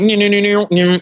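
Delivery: high, quick string of warning nos; alarmed no. Retold or imitated. Dad stopping his kid near danger, high warning nos. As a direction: fire off nos like a nervous dad.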